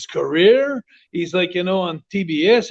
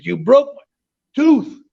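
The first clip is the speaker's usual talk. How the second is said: retold angry yell; 7 semitones higher, 4 dB louder, slower than usual. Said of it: "He said".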